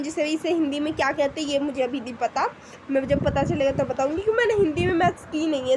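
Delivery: usual speaking voice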